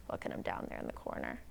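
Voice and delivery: quick, hushed whisper; whispered aside. Real, not acted. Reader pointing out Mister Neighbor in the picture, whispering.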